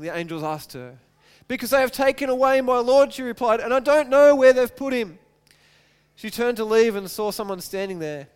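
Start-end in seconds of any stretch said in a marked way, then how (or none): 1.5-3.1 higher, louder urgent reply; mild anguish in a read-aloud voice; distressed plea. A narrator's reading. Weeping Mary answers the angels. 3.6-5.2 loud, high urgent reply; anguished, forceful reading of a quote; distressed plea. A narrator's reading. Weeping Mary answers the angels.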